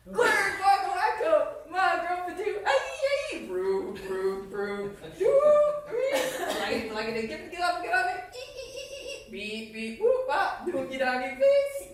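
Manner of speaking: high, loud goofy gibberish; silly nonsense sounds. Acted. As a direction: blurt out the nonsense words, squeaking. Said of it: "make silly sounds like".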